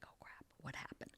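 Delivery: groggy, barely-there whisper; whispered alarm. Retold or imitated. Patient waking from anesthesia to her surgeon, whispering. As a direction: whisper it as if just coming round.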